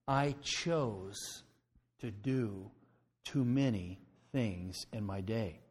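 "I chose" slow and deliberate, I weighted; stress on I CHOSE. Real, not acted. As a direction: lean on "I chose" then soften. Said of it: "Notice the words".

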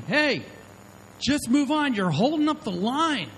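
mock road-rage yell, pitched high; retold angry yell. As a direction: yell it at the car blocking the lot. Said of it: "Starts screaming out his window".

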